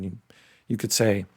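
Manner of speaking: ordinary talk, nothing marked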